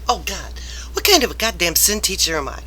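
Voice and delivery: ordinary talk, nothing marked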